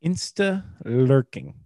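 Insta lurking said slowly for clarity; repeating Insta lurking slowly after what. Real, not acted.

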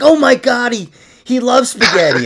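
high, loud, giddy gasp with a laugh; mock gushing owners. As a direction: play the gullible owners thrilled by the psychic. Imitating gullible dog owners.

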